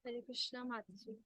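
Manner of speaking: very soft, faint speech; almost too quiet to hear; barely audible. Real, not acted. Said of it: "your voice is very low".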